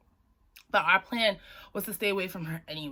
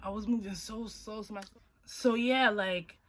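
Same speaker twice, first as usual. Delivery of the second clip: usual speaking voice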